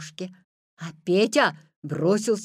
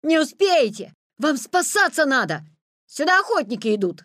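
loud, raised, insistent cries; urgent warning; 6 semitones higher, 6 dB louder than usual. A narrator's reading. Petya bluffing the Wolf about hunters, urgent loud warning. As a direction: warn with alarm.

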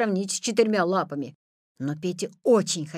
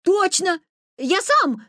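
high, loud assurance; emphatic insistence; 10 semitones higher, 7 dB louder, slower than usual. A narrator's reading. Petya convincing the doubting Wolf, high loud insistence. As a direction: insist eagerly at raised pitch.

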